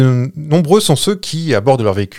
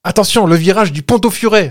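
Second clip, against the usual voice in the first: a higher, cried warning about "Ponto Furet"; retold cry; 8 semitones higher, 4 dB louder than usual. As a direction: call out the warning like the ghost girl. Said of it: "elle crie".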